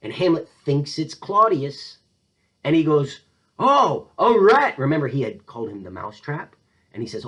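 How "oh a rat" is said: loud, high exclamation; acted outcry. Hamlet thinks Claudius is behind the curtain, acted outcry. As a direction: pounce on a sudden discovery.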